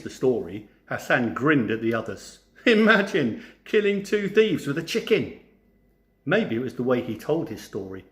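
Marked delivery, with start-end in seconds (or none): 2.6-5.4 gleeful. A narrator's reading. Hassan gloating over his story.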